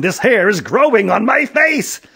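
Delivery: high, loud, frantic complaint; exasperated cry. A narrator's reading. Policeman telling Aaron about his face, exasperated.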